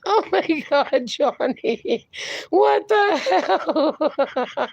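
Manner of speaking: high, drawn-out laughing exclamation; amused disbelief. Real, not acted. Host reacting to Johnny's joke, laughing disbelief. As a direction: exclaim helplessly while cracking up.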